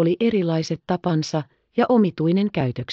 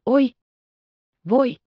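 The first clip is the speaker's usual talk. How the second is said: each "Voi" more upset than the last; a boy's cries of alarm; dismayed exclamations; 7 semitones higher than usual. A narrator's reading. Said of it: "huudahti Pekka yhä suuremmalla mielipahalla".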